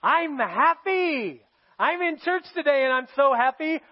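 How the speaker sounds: high, loud, slow fake cheer; mock happy face. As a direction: put on a cheesy grin. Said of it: "having the happy face".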